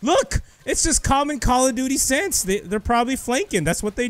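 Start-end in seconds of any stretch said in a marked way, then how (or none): 0.0-2.4 high-pitched, worked-up exclamation; vindicated outburst. Real, not acted. Gamer caught by predicted flankers, vindicated outburst. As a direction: cry out that you saw it coming.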